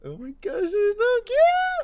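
high, drawn-out gushing over cute pets. Real, not acted. Friends finding hamsters, high gushing. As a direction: melt at something adorable.

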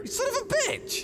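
high shrieking curse; betrayed outburst. Acted. Player cursing the king who lied about vodka. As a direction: scream it at the liar.

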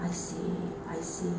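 very soft, low acknowledgement; calm and collected. Retold or imitated. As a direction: imitate a calm, unruffled listener. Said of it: "he was so calm and collected".

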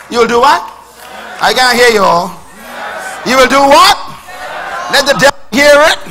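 loud, high, rousing call and response; fervent preaching. Acted. Preacher urging his congregation to shout the vow, rousing. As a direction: stir the church to answer back.

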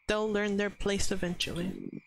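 normal talking voice

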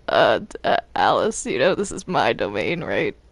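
usual speaking voice

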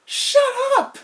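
laughing desperate shout; pleading yell. Real, not acted. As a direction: scream at them to stop. Pleading scream at friends.

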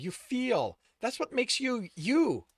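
high, loud heartfelt appeal; insist, begging to be believed; pleading. A narrator's reading. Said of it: "Kenny pleaded".

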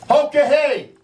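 high, ringing battle cry; exhorting war cry. A narrator's reading. Sitting Bull urges warriors into battle, war cry. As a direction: call the warriors to charge, high. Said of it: "He exhorts the warriors on".

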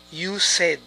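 "said" as SED, short vowel; correct pronunciation. Shown as a demonstration. Teacher repeating the correction, "said" as SED. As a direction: say "said" to rhyme with "bed". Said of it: "esto también es incorrecto. Es".

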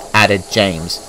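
ordinary delivery

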